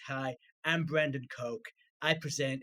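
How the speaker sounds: slow, basic American accent; generic American accent. Shown as a demonstration. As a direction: put on a root-one American presenter's accent. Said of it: "root one American accent like this".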